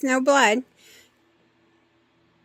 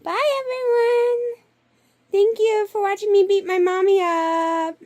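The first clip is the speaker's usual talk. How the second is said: voicing the pet; 8 semitones higher, 4 dB louder than usual. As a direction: speak sweetly as the pet, to the viewers. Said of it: "Can you say".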